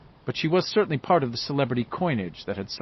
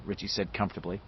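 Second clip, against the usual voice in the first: usual speaking voice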